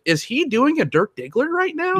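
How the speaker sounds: mocking high-pitched Dirk Diggler voice; mock the actor with a Diggler voice; Dirk Diggler impression. Watching Fassbender, mocks his accent as a high Dirk Diggler voice. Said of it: "his voice, his accent, I'm like".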